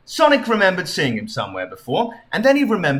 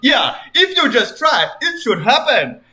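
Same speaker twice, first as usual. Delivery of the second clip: lightly voiced king; 7 semitones higher than usual. King Tunt.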